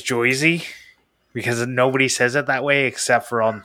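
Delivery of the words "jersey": "Joyzy" with an oy vowel in place of er; Jersey as "Joyzy". Shown as a demonstration. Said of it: "Don't say Jersey as Joyzy".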